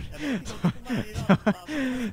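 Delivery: high, helpless giggle; uncontrolled high laughing fit; real laughter. Real, not acted. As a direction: crack up mid-commentary. Cracks up at co-host's remark.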